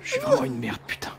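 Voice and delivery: murmuré sans voix; chuchoté. Real, not acted. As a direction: souffle l'aparté tout bas. Aparté pendant la scène.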